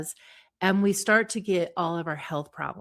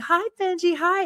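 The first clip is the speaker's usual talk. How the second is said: put-on much higher and louder sing-song baby talk; baby talk to dog; 10 semitones higher, 5 dB louder than usual. Owner on a video call calling to the dog Benji, high excited baby talk. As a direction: coo at the dog with big excitement. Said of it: "Benji gets on the phone and you're like".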